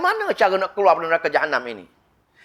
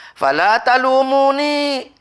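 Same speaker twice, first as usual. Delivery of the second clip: slow, higher, louder chanted phrase; Quranic recitation; 7 semitones higher, 4 dB louder, much slower than usual. Acted. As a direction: chant Fala talumuni slowly with lifted pitch. Satan's verse quoted.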